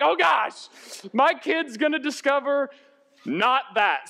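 high, hammed-up parental alarm; mock panic. Parent afraid the kid will find out, mock panicked. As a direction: play at dreading discovery.

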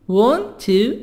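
WANT TO said as two words, voice rising; unreduced 'want to', T clearly sounded; full careful form. Shown as a demonstration. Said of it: "American, wanna".

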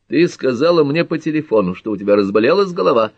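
indignant cross-examination; accusing question. A narrator's reading. Jealous Carter interrogates Pat.

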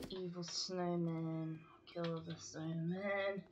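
drawn-out doom voice; slow dramatic. Pretend. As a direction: stretch every word, mock ominous. Mock-dramatic game villain lines.

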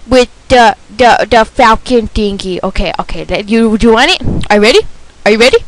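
ordinary talk, nothing marked

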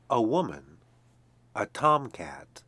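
plain reading voice

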